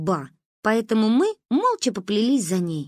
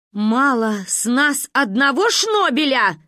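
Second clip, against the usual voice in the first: slow, loud, higher exasperation; angry; 4 semitones higher, 5 dB louder, slower than usual. A narrator's reading. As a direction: hammer out each word crossly. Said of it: "рассердилась мама".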